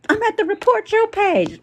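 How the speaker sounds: high, whiny mock of a sore loser; mock crybaby whine. Mocking a chat troll who threatens to report the page, high mock whine. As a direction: whine it like a kid tattling.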